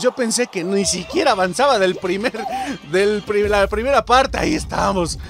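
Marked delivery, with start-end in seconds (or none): none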